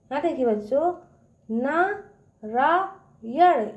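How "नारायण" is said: Narayan very slow, in three separate syllables; broken into three parts. Shown as a demonstration. Teacher shows kids a word in three parts, slowly. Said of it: "इसको तीन भागों में तोड़ा गया है".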